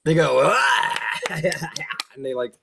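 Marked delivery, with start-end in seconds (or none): 0.4-1.2 high devil yelp. Acted. Devils cry out, clapping.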